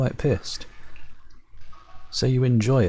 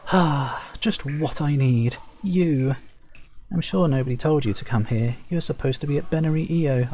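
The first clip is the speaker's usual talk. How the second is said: higher, exasperated theatrical sigh voice; officious overseer; 5 semitones higher than usual. Acted. Zulak annoyed that a Shaper disrupts her day, exasperated. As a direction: brush off the unwanted visitor.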